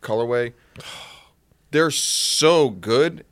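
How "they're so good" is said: enthusiastic. Real, not acted. Praising favorite shoe.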